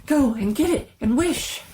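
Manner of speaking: eager, breathless urging; excited wife. A narrator's reading. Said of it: "quivering with excitement".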